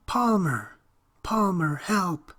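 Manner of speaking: high, panicked calling; boy calling his name from far within; squealing cry for help. Acted. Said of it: "The boy's squealing voice began to echo".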